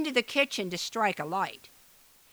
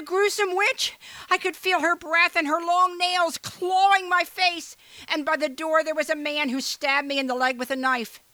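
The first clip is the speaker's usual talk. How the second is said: high, louder panicked voice; terrified robber; 9 semitones higher, 4 dB louder than usual. Acted. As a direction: gasp it out in panic to the boss. Terrified robber tells captain.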